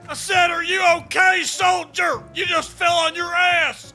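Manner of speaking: barking drill sergeant, shouting at a fallen soldier. Acted. Drill sergeant yells at the soldier who fell, barking. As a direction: yell it like a furious drill instructor.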